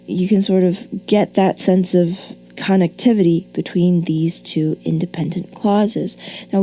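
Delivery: ordinary talk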